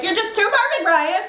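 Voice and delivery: high-pitched mock gushing; exaggerated adoring squeal of praise; gushing girlfriend. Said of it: "because she's always like".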